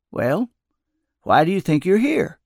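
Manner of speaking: gently probing, rising question; careful. A narrator's reading. Old man questioning the upset narrator, carefully. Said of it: "Carefully, Jones asked".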